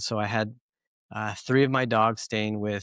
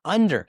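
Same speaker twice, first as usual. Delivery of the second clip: Under said higher and louder, falling firmly; emphatic correction; 6 semitones higher, 5 dB louder than usual. Real, not acted. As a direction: insist on Under, punched and dropping. Corrects partner: under the tongue.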